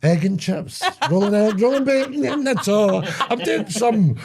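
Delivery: high, laughing sales patter; giggly food-seller routine; mock street hawker.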